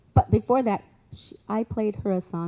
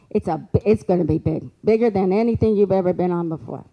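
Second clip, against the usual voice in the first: emphatic prediction; 8 dB louder than usual. Retold or imitated. Psychic's prediction acted out.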